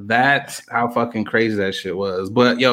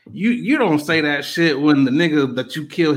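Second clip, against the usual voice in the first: ordinary talk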